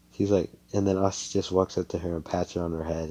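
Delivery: ordinary talk, nothing marked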